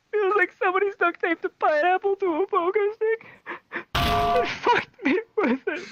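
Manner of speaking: high strained voice, slowing at the end; pained squeal. Retold or imitated. Hurt kid explaining to the nurse, high mock agony. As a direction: strain it out, dragging the last words.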